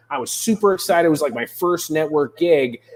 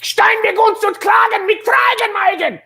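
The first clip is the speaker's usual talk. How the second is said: screamed fake German. Shown as a demonstration. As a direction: bellow it like a furious German. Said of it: "but scream it".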